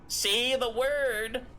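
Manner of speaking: high, very slow birdlike plea; mimicking bird. Bird wizard urging a townsperson to speak, slow birdlike voice. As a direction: coax it out like a strange little bird. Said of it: "a random bird going like".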